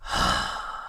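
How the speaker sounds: long exhaled sigh; weary sigh. Acted. The duo sit with their coffee after the chaos, sighing. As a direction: sigh out heavily. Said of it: "with their coffee and sigh".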